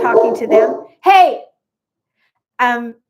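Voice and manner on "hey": sudden high, loud yell; one loud burst, pitched way up; real shout. Real, not acted. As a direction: shout to stop the dog barking. Shouts at barking dog mid-chat.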